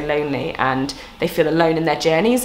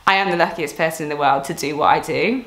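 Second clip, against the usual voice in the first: normal talking voice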